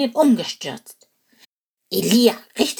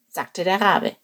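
ordinary delivery

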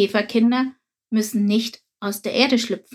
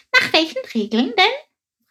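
high, loud, puzzled question; a sparrow who can't believe it; astonished question; 11 semitones higher, 4 dB louder than usual. A narrator's reading. Sparrow Chilp asks the beetle in astonishment. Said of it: "fragte Chilp erstaunt".

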